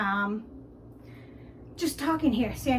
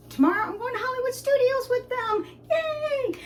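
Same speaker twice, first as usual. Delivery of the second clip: much higher, thrilled squeal; excited; 12 semitones higher than usual. Real, not acted. Telling viewers about tomorrow's trip, a thrilled high squeal. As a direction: squeal with delight.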